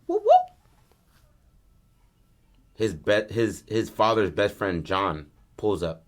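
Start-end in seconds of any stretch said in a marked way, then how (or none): 0.0-0.3 high-pitched police siren whoops; mouthed cop-car whoop-whoop; siren whoop imitation. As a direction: imitate a short double siren chirp. Mimics police car siren chirp.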